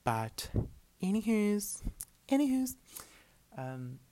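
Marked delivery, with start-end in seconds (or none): 1.0-1.8 "anywho's" squeaked high and loud, sing-song; playful anywho. Acted. Playful topic switch after rant. 2.3-2.8 "anywho's" squeaked very high and loud, falling at the end; even higher anywho. Acted. Repeated playful topic switch.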